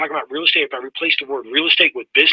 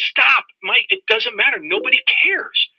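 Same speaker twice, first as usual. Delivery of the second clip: stern mentor; 11 semitones higher than usual. Retold or imitated. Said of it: "He says".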